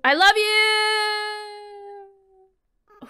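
long, high, drawn-out cry; mock-dramatic yell of love; shouted love confession. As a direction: belt it across the room. Yelling love confession at Undyne.

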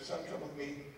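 gentle coach. Retold or imitated. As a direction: speak softly to the boy, kindly. Coach speaking gently to boy.

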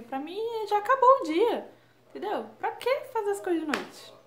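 high, loud exasperated disbelief; exasperated rant. Real, not acted. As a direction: complain as if it's absurd. Indignação exagerada com a noite. (indignação exagerada, aguda e alta)

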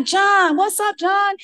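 high, loud, chummy greeting; buddy-buddy greeting. Retold or imitated. Intern greeting the cool attending, high and chummy. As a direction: hail him like an old pal.

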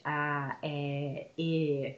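drawn-out vowel demo, one at a time; stretched vowels. Shown as a demonstration. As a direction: hold every vowel in "Ah, eh, eh". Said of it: "sounds that you can stretch".

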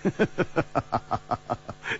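long run of soft staccato chuckles; amused laugh. A narrator's reading. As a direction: laugh softly in short puffs. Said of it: "楚留香笑着说".

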